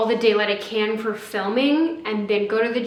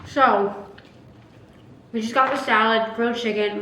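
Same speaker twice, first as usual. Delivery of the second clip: ordinary talk, nothing marked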